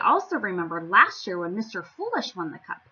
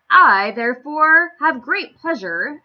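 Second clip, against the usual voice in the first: slow, loud royal proclamation; grand announcement; 4 semitones higher, 10 dB louder, slower than usual. Acted. King announces the winner to the crowd, slow and grand. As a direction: announce it with ceremony. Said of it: "announced the king".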